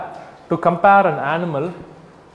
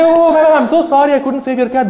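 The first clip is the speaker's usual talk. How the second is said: high, louder hammed-up apology; mock apologetic firefighter; 8 semitones higher, 5 dB louder than usual. Firefighter fakes sorrow to the cat owner, hammed-up apology. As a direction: overplay a fake sorry to the owner. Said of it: "I come to you and say".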